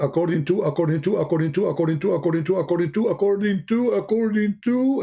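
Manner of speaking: high singsong loop, climbing and stretching at the end; mocking repetition. Mocking endless chain of attributions.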